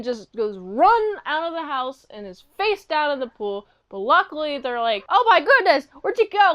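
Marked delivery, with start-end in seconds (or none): 5.1-6.5 high, loud acted alarm; mock panic. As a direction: call out like a panicking parent. Acting out alarmed parents.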